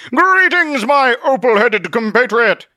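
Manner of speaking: very high, loud theatrical greeting; grand hail. Acted. Zealot waves to Truth, grand hailing bluster. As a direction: hail them slowly and pompously.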